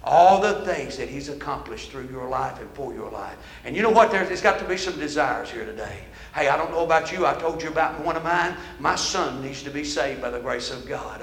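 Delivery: low, softened pastoral appeal; solemn altar call. Real, not acted. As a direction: quietly guide them toward prayer. Preacher leads altar call.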